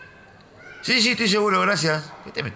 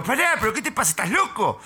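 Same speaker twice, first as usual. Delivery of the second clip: high, panicky objection; high-pitched acted alarm; alarmed mock protest; 10 semitones higher than usual. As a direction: plead urgently for the attacker to stop. Acted protest under attack.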